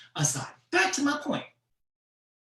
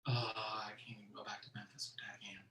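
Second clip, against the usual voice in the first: fast, much softer whisper; whispered aside; 6 semitones lower, 13 dB quieter, faster than usual. Real, not acted. Comic telling the audience an embarrassed aside: fast whisper. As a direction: mutter it under your breath, fast.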